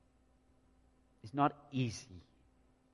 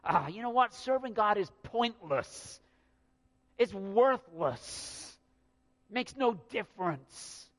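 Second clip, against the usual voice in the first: higher, scoffing dismissal; dismissive complaint; 6 semitones higher, slower than usual. Retold or imitated. Preacher voicing grumblers who call serving God pointless, scoffing. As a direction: brush it off as pointless. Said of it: "they're saying".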